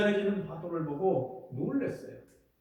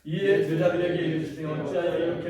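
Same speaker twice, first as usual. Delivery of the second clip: scripture reading; 4 dB louder than usual. Acted. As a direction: intone the verses like a chant. Reads scripture aloud.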